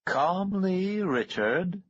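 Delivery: high, calm and soothing; calm the other man down; smooth refined voice. A narrator's reading. Barrent's voice.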